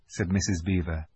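ordinary delivery

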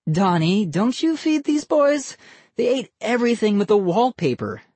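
high teasing mother voice; playful scolding woman; amused mom. A narrator's reading. Mother teases uncle.

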